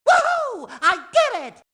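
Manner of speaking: high triumphant whoop; excited cheer. Acted. Sponge who just did it, excited high cheer. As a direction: celebrate a win out loud.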